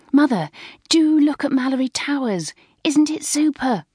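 high, gushing enthusiasm; thrilled daughter urging her mother to look; excited schoolgirl. A narrator's reading.

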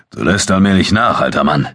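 put-on teasing jab at an old friend; mock him lightly, grinning; playful banter between battle comrades; joking. A narrator's reading. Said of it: "scherzte Abu Dun".

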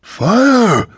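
high, loud battle cry; shouted command. A narrator's reading. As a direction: yell the order to fire. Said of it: "Kyla shouted".

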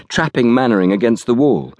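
usual speaking voice

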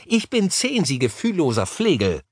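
put-on high, offended child voice; sulky, cross girl. A narrator's reading. Girl scolds Harry over her age, cross and pouting. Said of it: "schmollte das Mädchen erbost".

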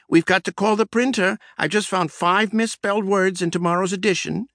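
high squeaky mouse voice, urgent; squeaky secretary. Acted. Secretary rushing in squeaks about misspelled words, high and urgent. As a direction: squeak out the bad news, rushing. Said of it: "she squeaked".

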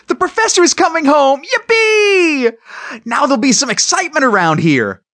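put-on high, loud, fast boyish whoop; overjoyed cheering. A narrator's reading. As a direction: shout the good news, racing and jubilant. Boy cheers professor's homecoming.

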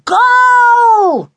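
long, high triumphant roar; very loud drawn-out football cry. A narrator's reading. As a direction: cheer a goal at full voice. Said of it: "roared Clipper".

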